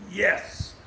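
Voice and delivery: whispered exclamation of victory; hushed triumphant yes. Retold or imitated. Hunter reliving the deer going down, whispered triumph. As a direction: celebrate under your breath. Retold triumph after firing shot.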